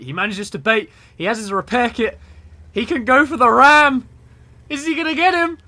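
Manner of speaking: rising to a high, loud shout; frantic, high-pitched live call; building excitement. Real, not acted. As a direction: shout the play as it climbs to a climax. Live call of climactic ram attempt.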